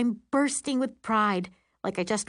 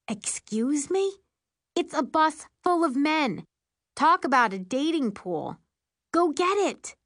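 excited; 7 semitones higher than usual. Acted. Urging mom to chase men.

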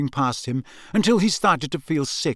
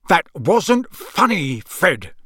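slow, louder scolding father; angry father's shout, higher and slow; 3 semitones higher, 4 dB louder, slower than usual. A narrator's reading. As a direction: bite out each word at your son. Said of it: "he shouted".

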